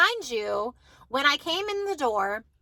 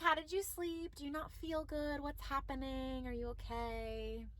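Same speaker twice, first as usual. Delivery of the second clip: very soft, hushed motherly check-in; gentle concern; 17 dB quieter than usual. Retold or imitated. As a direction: ask tenderly, slowing toward the end. Retold gentle check on unwell son.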